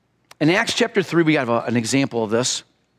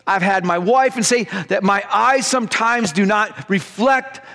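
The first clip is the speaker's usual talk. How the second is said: fiery preaching; 7 semitones higher than usual. Acted. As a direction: preach it out with full force, building into prayer. Preaching at full force.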